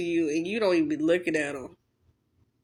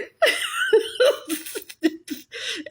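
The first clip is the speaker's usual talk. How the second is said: giggling at her own joke; high burst of laughter. Real, not acted.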